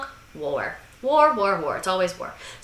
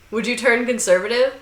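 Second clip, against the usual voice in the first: ordinary talk, nothing marked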